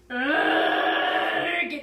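a loud, drawn-out sick groan; loud moan. Acted. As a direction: groan like you feel awful. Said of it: "he moaned".

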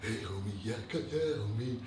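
mock him with a sugary, hushed "homie"; imitating a fake-nice host greeting fans softly. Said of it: "he gets, like, a little soft voice".

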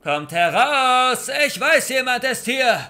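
full-force threatening call; shouting. A narrator's reading. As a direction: bellow it out into the woods. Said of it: "schrie er direkt in unsere Richtung".

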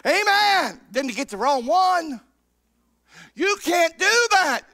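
high, loud, shouted sermon climax; hollering revival preacher who won't give an inch; preaching at full force. Acted. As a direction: whip the congregation up, pitch rising, voice at full stretch.

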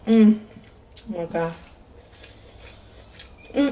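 savoring a bite; chewing while talking. Real, not acted. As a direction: mouth partly full of food. Seen 2: Bit shrimp at -2; mouth still working, chewing during words.

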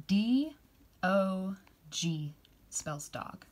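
slow, drawn-out spelling. Shown as a demonstration. Teacher modelling dog, slowly. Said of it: "Can you say that with me".

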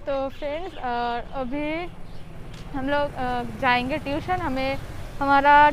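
speaking behind fabric mask; through a cloth face mask. Real, not acted. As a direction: mouth covered by cloth. Seen 3: Checked cloth mask covers mouth and nose in every frame; none in references.

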